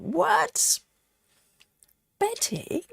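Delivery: high, stunned disbelief; shocked. Real, not acted. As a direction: gasp out her name in shock. Shocked friend dislikes chocolate.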